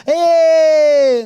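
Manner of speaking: high, loud delighted yelp; excited child cheer. Acted. Spoiled child getting an iPhone, loud excited cheer. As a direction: squeal like a kid getting a prize.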